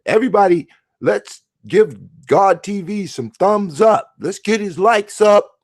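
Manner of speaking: mock hype host. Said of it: "I'll be like".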